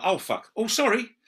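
high, loud slip then apology; blurting, then sheepish sorry; startled swear. Retold or imitated. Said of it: "you go".

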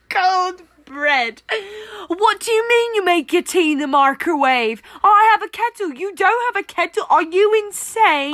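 high, outraged British caricature; mock British accent. Mock horror at microwaved tea, exaggerated British accent. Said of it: "trying to make fun of the British".

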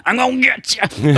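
high, menacing gloating threat; menacing competitive voice. Retold or imitated. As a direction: growl it like a sore competitor. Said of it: "And I'm just there like".

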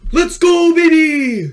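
loud high cheer. Real, not acted. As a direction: shout it in celebration. Cheering together.

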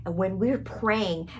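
ordinary talk

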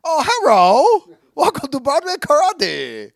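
loud high mock accent; hammed-up shop greeting; Chinese accent.

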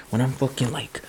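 soft unvoiced whisper of the same words; whispered aside about getting ripped. Real, not acted. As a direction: whisper it like a secret. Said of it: "repeats it whispered".

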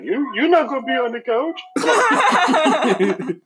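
very high, squeaky pet voice; high-pitched, pleading pet impression; voicing the pet. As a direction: speak for a hurt pet in a high voice. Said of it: "He's looking at me like".

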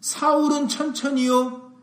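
imitated acclaim, drawn out; crying out. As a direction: call it out like a crowd's chant. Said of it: "여인들이 뭐라고 외쳤다고".

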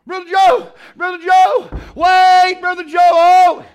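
high, loud urgent holler; yelling. Retold or imitated. Husband to the preacher as the service closes, yelling. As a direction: yell it out to stop the preacher. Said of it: "He's yelling".

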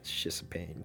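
tired, breathy whisper after a sigh; weary whisper. Real, not acted. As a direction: sigh it out under your breath. Sigh about ADHD struggle.

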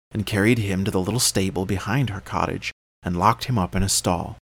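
ordinary delivery

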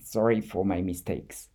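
ordinary delivery

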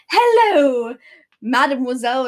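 hammed-up French charmer greeting; French accent. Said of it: "in his French accent".

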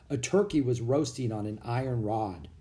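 plain reading voice

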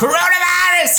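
very high, long drawn-out yell; mock shouting. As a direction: holler it out like a comic alarm. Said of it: "Schreist du auch". (langgezogenes, schrilles Herausschreien)

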